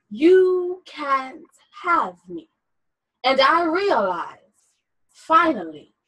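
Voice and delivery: slow, measured poem reading; poetry reading. Acted. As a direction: read it like a spoken-word poem. Reads a poem excerpt aloud.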